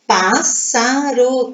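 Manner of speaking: "Pássaro" slowly split into separate syllables. Shown as a demonstration. Teacher showing pupils three syllables, "Pássaro" slowly syllabified. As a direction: draw out "Pássaro" one syllable at a time.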